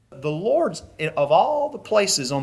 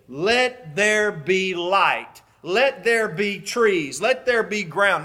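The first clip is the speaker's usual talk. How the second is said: slow, raised, measured decree; solemn, drawn-out pronouncement; God commanding creation; 3 semitones higher, much slower than usual. Acted. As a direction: speak creation into being, very slowly. God speaking creation into existence.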